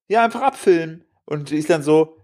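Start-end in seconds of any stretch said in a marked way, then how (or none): 0.1-1.0 high-pitched mock insistence; lightly voiced woman brushing off the question; imitating young woman. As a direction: pitch jumps up for her reply. Young woman insists on being filmed.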